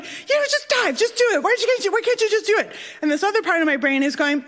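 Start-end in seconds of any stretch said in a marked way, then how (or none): 0.0-2.7 high, urgent self-nagging; pleading, exasperated and pitched way up; frantic inner voice. Retold or imitated. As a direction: imitate a panicking brain egging you on.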